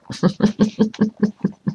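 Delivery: soft string of chuckles; amused chuckle. Real, not acted. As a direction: chuckle quietly at your own answer. Amused by own answer.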